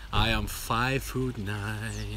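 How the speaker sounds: very slow, stretched-out statement; drawn-out announcement. Pretend. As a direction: announce your height slowly, drawing out NINE. Mock-formal answer about own height.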